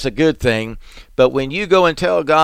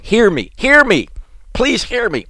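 each "hear me" climbs higher, up to a high, urgent cry; begging God more urgently each time; rising desperate plea; 7 semitones higher than usual. Acted. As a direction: beg harder with every repeat.

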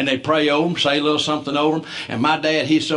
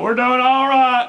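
put-on high, slow hearty old man greeting; dad on the phone; 8 semitones higher, 3 dB louder, slower than usual. Dad answering his son's call, hearty and slow. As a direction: answer the phone big and cheerful.

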